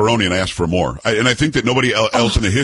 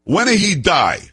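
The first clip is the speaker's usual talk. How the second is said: higher-pitched mock Italian accent; thick Italian accent; 7 semitones higher than usual. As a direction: demand it like a bold Italian woman. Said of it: "Italian accent and says".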